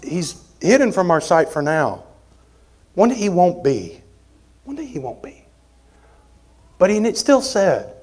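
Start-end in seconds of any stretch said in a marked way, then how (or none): 4.6-5.4 much softer, almost whispered; quiet whispered echo; hushed repeat. Real, not acted. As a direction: let the promise fall to a hush. Repeated line, hushed second take.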